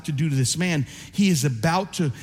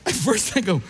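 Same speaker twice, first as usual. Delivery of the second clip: normal talking voice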